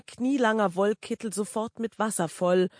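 usual speaking voice

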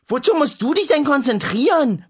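high, loud teasing yell; bellowed mockery. Sister yells at her brother from next door, mocking. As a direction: shout through the wall, mocking. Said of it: "brüllte Lea".

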